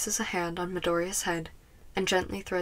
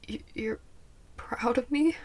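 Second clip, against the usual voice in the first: higher-pitched, hesitant rising question; stammering disbelief; 5 semitones higher than usual. A narrator's reading. Tearful student stammers in disbelief.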